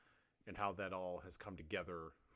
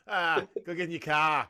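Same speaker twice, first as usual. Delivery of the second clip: put-on loud, high Boston accent shout; Matt Damon; 9 semitones higher, 19 dB louder than usual. Host quoting the bar scene from the film, shouted Boston accent. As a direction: holler it in broad Boston. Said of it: "Matt Damon in Good Will Hunting".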